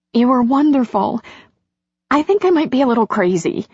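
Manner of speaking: high-pitched, quick woman's voice; emotional heroine. A narrator's reading. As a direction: gush it high and fast.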